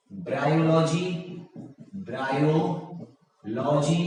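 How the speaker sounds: Bryology slowly, with a gap before logy; drawn out. Shown as a demonstration. Teacher repeating an option for the class, drawn out. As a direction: split bryology into two slow parts.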